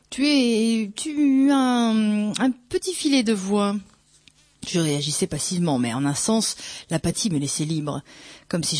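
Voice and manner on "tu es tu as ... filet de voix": higher, drawn-out singsong tease; amused, ticklish teasing. A narrator's reading. Narrator teasing the priest about his thin voice, amused. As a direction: tease playfully, stretching the words out. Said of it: "très amusé, sur le ton du chatouillement".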